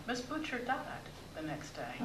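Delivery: normal talking voice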